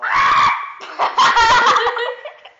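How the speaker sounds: high, loud burst of laughter. Real, not acted. Laughing after entering.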